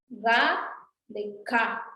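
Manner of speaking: "Ga de ka" drawn out slowly; "ga" then "ka", set apart clearly and slowly; slow contrast demo. Shown as a demonstration. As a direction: contrast "Ga" voiced and "ka" unvoiced, slowly. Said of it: "Es diferente ka que ga".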